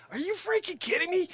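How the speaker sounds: can't believe his luck; thrilled, almost squealing surprise; amazed disbelief. Real, not acted. Stunned by a stranger's help.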